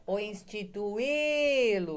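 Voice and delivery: "instituí-lo" called slow and loud; calling out the word. Shown as a demonstration. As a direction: stretch and lift the stressed "í" of "instituí-lo", as if calling. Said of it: "Chama a palavrinha aí, nós vamos chamar".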